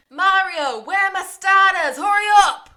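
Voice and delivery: loud, high chef bellowing orders; a hammed-up busy chef shouting; mock kitchen yell. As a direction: yell across the kitchen at Mario. Chef yelling at kitchen staff.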